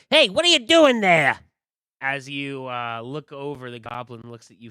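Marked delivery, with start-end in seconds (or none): 0.1-1.4 high, loud "who goes there" yell; sudden high shout at intruders; startled shout. Acted. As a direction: call out in alarm as a guard. NPC calls out at the party.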